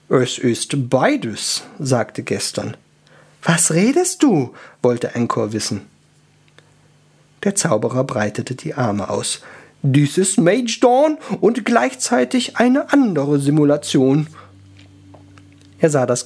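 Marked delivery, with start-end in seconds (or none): 0.1-1.6 very slow, mannered wizard; slow, affected wizard voice; solemn wizard. Acted. Wizard explains the glitch. 3.4-4.5 high-pitched elf question; high elf voice, demanding; puzzled elf. Acted. 9.8-14.3 slow, high, theatrical wizard; stagey wizard announcement, pitched up; proclaiming wizard. Acted. Wizard proclaims with spread arms.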